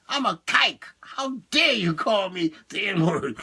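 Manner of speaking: hammed-up offended protest; mock outrage. Man objecting to an insult, mock-outraged. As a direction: act affronted at the insult.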